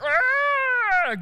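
high, loud "ah" of a tossed lion; mock lion yell. Lion thrown up by a buffalo horn, high mock yell. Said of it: "the lion's like".

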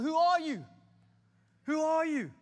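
loud, high, forceful demand; defiant interrogation; challenging the devil. Acted. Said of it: "say, "Hey, devil".

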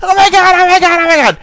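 high-pitched frantic mock yell; imitated yelling. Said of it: "She's yelling".

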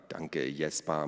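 low, soft sarcastic mutter; grudging thanks under the breath; grumbled thanks. A narrator's reading. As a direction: grumble it sullenly. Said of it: "murrte Naru".

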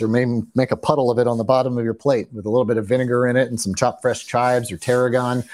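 ordinary talk, nothing marked

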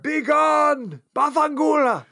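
high, shouted Florentine Italian dismissal; Florentine Italian accent. Retold or imitated. Blind lady shouting at housekeeper in Florentine Italian accent. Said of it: "Florentine accent".

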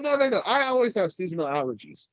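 normal talking voice

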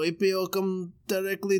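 mock French doctor, lightly done; French accent. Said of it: "in a French accent".

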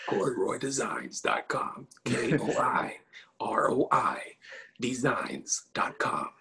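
slow, drawn-out announcer read; lightly voiced ad announcer. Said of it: "the whole announcer voice going on there".

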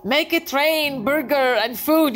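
jokey high-pitched command to God; mock demand to God. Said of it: "as if you're telling him".

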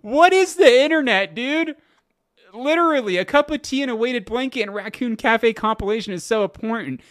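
giddy disbelief. Real, not acted. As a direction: rattle it off in delighted disbelief. Giddy recap of thread.

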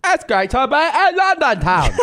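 high, hammed-up British accent. Comic showing off to co-host, high fake British accent. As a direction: pitch it high in a badly faked British accent.

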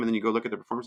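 ordinary talk, nothing marked

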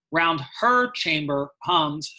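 slow line, hit the word "her"; stress on her. Shown as a demonstration. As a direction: punch "her" to flag the pronoun. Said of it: "ask about the change in pronoun".

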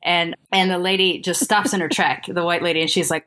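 ordinary talk

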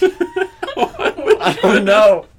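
giggling helplessly; laughing. Real, not acted. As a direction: squeeze the words through laughter. Both cracking up.

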